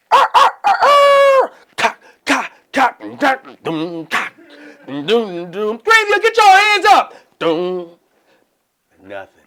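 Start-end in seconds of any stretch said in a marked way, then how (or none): 0.1-7.1 loud high rooster then beat sounds. Retold or imitated. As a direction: imitate the song intro full blast.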